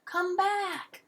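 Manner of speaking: high, airy call after a cat; high-pitched, pleading call. A narrator's reading. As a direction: call after a runaway pet. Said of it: "Mister Sims called out".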